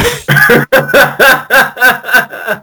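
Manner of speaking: long high giggle; high laugh. Real, not acted. Host cracking up at his own film pick.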